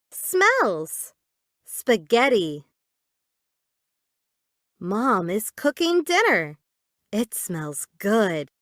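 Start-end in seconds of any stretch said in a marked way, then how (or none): none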